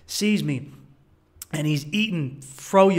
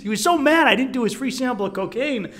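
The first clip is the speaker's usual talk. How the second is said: ordinary talk, nothing marked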